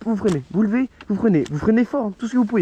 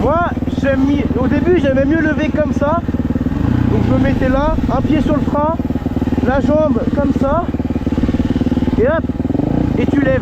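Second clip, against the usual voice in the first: raised outdoor voice, louder and higher; projecting over the wind; shouting over wind; 4 semitones higher, 3 dB louder than usual. Real, not acted. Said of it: "je sais pas si vous m'entendez bien avec le vent".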